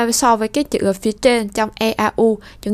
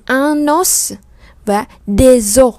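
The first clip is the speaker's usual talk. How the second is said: un os and des os said slowly, des os louder; slow careful demo; 2 semitones higher, 5 dB louder, much slower than usual. Shown as a demonstration. Teacher slowly contrasts singular and plural for class. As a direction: spell out the two forms deliberately. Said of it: "cái từ ngoại lệ như từ os này".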